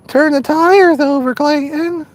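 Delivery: high-pitched mock teenager; teenage voice impression. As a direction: imitate a teenage kid egging him on. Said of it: "my best impression of a teenage voice".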